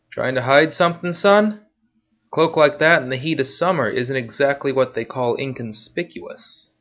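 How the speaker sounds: mocking gate guard questioning a traveller; gruff guard voice. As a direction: needle the hooded stranger like a bored guard.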